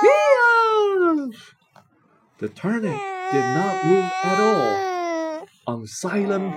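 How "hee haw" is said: high, loud hee-haw; donkey bray. A narrator's reading. Narrator imitates a donkey.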